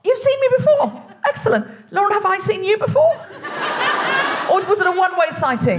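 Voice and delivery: light up at meeting a fellow Aussie; delighted laugh. Real, not acted. Delighted amused surprise.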